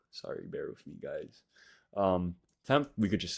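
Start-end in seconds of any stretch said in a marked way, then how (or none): 0.1-1.4 lisp from retainers. Real, not acted. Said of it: "I'm gonna have a little bit of a lisp".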